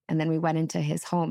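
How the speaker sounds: ordinary talk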